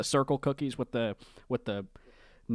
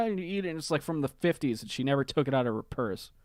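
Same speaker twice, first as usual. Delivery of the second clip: usual speaking voice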